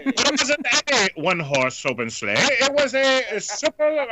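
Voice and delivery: mock drunk Keith Jackson. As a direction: play the tipsy sportscaster. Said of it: "Keith Jackson would be drunk".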